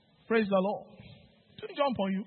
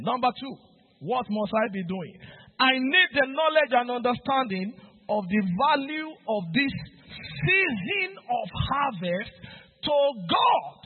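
ordinary delivery

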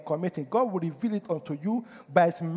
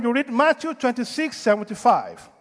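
normal talking voice

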